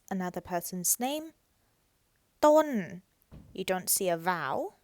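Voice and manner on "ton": Ton loud, high and falling; Thai falling tone. Shown as a demonstration. Teacher modelling Ton with a falling tone. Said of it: "ton with a falling tone".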